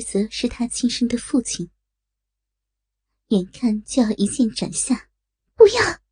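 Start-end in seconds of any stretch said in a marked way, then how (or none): 5.5-6.0 high, loud desperate shout; urgent cry. Acted. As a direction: cry out to stop the blade. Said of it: "又是一声清脆黄急的呼叫".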